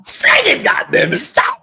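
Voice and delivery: high-pitched hype-man outburst; hype interjection. Real, not acted. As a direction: cut in high, egging the host on. Co-host hypes up the introduction.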